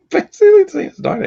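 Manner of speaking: words squeezed out through a high laugh. Real, not acted. Laughing at the start of a story to listeners. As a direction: laugh while you get started.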